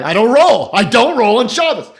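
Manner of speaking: loud indignant mock yell; mock shout. Walter refusing to bowl on Shabbos, loud indignant shout. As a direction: bellow it like a furious bowler. Quoting Walter's famous outburst.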